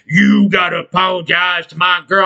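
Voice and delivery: put-on yelling voice, not at full volume; half-strength retold scream in an altered voice; mock screaming demand. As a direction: imitate an irate drunk boyfriend yelling. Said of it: "started screaming at me".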